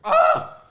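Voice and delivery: high loud yelp with laugh; pained laughing yelp. Real, not acted. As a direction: cry out half laughing. Hot sauce burning.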